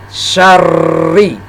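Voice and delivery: SYARRI with a long, pressed double r; doubled, pressed r. Shown as a demonstration. Teacher demonstrating tasdid to learners, pressed double r in syarri. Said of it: "membacanya ditekan suara kita".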